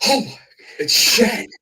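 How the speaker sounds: high, loud "oh my, it's Shad"; surprised recognition. Retold or imitated. Retelling the roadside meeting, surprised and excited. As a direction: gasp it out as recognition hits.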